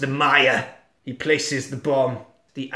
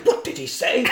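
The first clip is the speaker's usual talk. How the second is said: high, laughing mock old lady; 8 semitones higher than usual. Old lady asking what Madison said, laughing. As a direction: ask it through a laugh, dotty. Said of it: "she's going".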